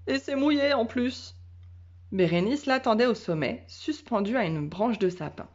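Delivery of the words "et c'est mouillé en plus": grumbling dog. A narrator's reading. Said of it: "ce qui voulait dire".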